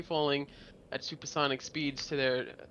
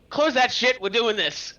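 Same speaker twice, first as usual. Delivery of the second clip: loud, higher urgent command; shouted order; 7 semitones higher, 7 dB louder than usual. Retold or imitated. War planners scrapping the bat bomb, shouted order. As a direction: call out an abrupt mock order.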